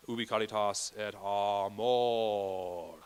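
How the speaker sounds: ubi caritas et amor, slow with a flipped R; slow Latin diction. Shown as a demonstration. Director demonstrates Latin diction for singers. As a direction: speak each Latin syllable slowly and cleanly. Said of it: "for example".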